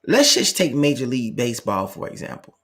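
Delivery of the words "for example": soft voiceless trail-off; whispered. Real, not acted. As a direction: drop to a whisper.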